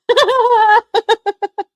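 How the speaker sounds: loud, high burst of laughter; real laugh. Real, not acted. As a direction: crack up loudly, pitched high. Laughs after asking why.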